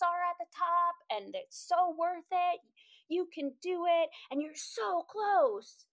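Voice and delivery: high, drawn-out mock cheering; gushing encouragement. Hikers coming down urge on the struggling climber, imitated gushing encouragement. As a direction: imitate over-keen encouragers, high and stretched out.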